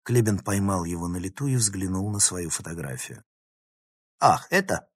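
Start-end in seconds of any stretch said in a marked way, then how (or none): 4.2-4.9 lightly offhand, pitch lifted; calm, offhand. A narrator's reading. Professor glances at the journal, calmly unconcerned. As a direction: brush it off with an easy "oh, that". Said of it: "спокойно произнёс он".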